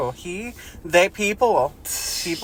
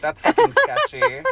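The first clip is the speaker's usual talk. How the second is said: much higher, slow, dragged-out squeak; mock suspicious; 5 dB louder, slower than usual. Real, not acted. Host reacting to the character's suspicious line, high and slow. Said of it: "exaggerated suspicious reaction".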